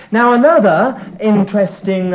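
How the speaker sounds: ordinary talk, nothing marked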